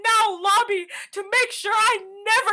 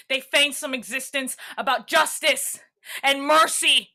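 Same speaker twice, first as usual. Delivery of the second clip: ordinary talk, nothing marked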